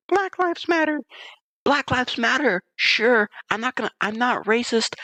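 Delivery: very high, sing-song mock chant; very high sarcastic imitation of women; mocking protesters. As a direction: chant it like self-righteous protesters.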